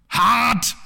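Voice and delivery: high, sharp bark of a single word; tough-guy shout at the audience; punchline shout. Acted. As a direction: fling out the rhyme like a battle cry.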